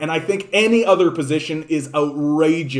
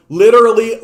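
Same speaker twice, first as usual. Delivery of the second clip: loud; 6 semitones higher, 8 dB louder, faster than usual. Real, not acted. Angry rant.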